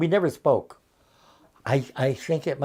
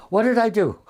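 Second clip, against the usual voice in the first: higher and louder, pleading; second ask, more upset; more distressed girl; 7 semitones higher, 5 dB louder than usual. Retold or imitated. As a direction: push the worry further, almost panicked. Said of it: "a girl came in from lunch crying".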